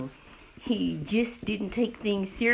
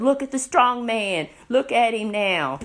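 mock jeering, higher and louder; making fun; 4 semitones higher, 6 dB louder than usual. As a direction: jeer like a taunting crowd. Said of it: "laughed and made fun of him".

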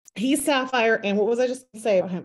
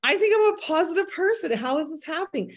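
alarmed self-talk; 6 semitones higher than usual. Retold or imitated. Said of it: "I've been like".

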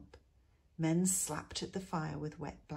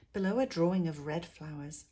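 ordinary delivery, nothing marked

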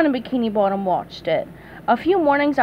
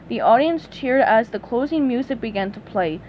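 usual speaking voice

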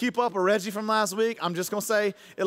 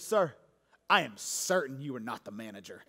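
much lower, softer, firm; quietly dangerous wife; calm stern wife; 8 semitones lower, 5 dB quieter than usual. Retold or imitated. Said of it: "she leaned across and she goes".

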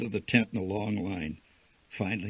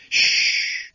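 sharp, loud hissed shush; indignant shush; 9 dB louder than usual. A narrator's reading. Patriots hushing the complainer, sharp hissed shush. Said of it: "indignant patriots hissed back".

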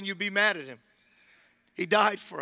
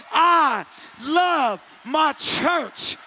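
slow, high, loud yell; the preacher shouting the church's motto; full shout; 7 semitones higher, 5 dB louder, much slower than usual. Shown as a demonstration. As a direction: yell it from the top of your lungs. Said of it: "yell it from the top of their lungs".

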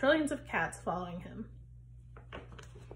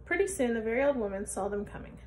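plain reading voice

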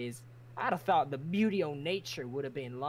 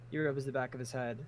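normal talking voice

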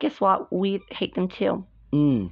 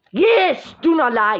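raised, acted contradiction; angry retort; 7 semitones higher, 5 dB louder, slower than usual. Answering a rival who denies the plan, angry raised retort. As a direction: throw the denial back, high and loud.

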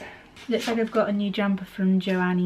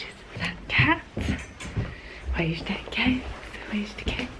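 whispering; 3 semitones higher, 6 dB quieter than usual. Real, not acted. Whispering to the cat.

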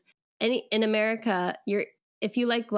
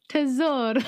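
Tesoro with Italian vowels and a tapped r, higher and louder; Italian accent; 5 semitones higher, 4 dB louder than usual. Real, not acted. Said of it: "Why are you saying it like that?".

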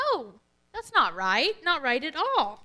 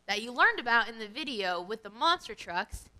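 ordinary delivery

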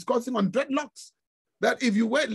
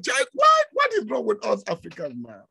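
exasperated outcry. Real, not acted. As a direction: cry out in disbelief.

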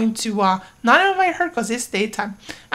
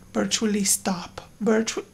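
urgent whisper; 5 dB quieter than usual. Real, not acted. Whispering at the sim to stop, urgent hush. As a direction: whisper it under your breath. Hushed at game.

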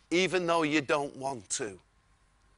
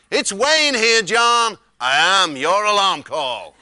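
booming friendly wake-up call; loud cheery announcement; 11 dB louder, slower than usual. Retold or imitated. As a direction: proudly announce yourself, loud. Hotel worker's cheery wake-up call.

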